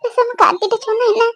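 soft, high tearful murmur; hesitant, halting words between sobs; crying. Acted. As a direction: think aloud through sobs. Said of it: "அழாத, தயவு செஞ்சு அழாத".

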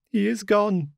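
high, rising hysterical cry; a sick maid breaking into wild hysterics. A narrator's reading. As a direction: tip over into hysteria. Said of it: "shriek after shriek of laughter".

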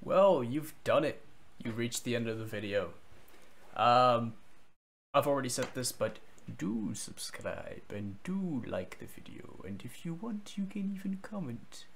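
measured mentor voice with rising, lilting swells; Master Uguay. Acted. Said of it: "That was my Master Uguay, uh, impression".

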